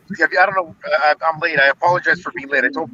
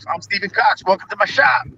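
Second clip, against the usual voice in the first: higher-pitched imitation of a video host's welcome; Steven Cox channel intro; 5 semitones higher than usual. Guest playfully quoting the host's channel intro back to him, upbeat imitation. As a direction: mimic a host's opening catchphrase. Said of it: "when you started up your channel".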